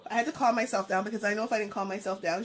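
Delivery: normal talking voice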